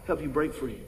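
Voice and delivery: ordinary talk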